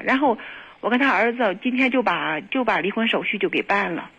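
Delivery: ordinary talk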